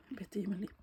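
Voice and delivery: ordinary talk, nothing marked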